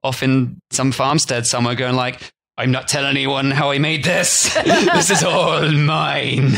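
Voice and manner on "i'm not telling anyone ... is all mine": slow, laughing mock Irish farmer; a drunk farmer's half-Irish brag, chuckling; Irish-ish farmer. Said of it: "Don't know why he's Northern Irish".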